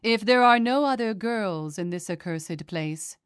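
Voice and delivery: ordinary delivery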